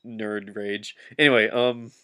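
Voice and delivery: normal talking voice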